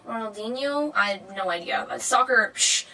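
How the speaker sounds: ordinary talk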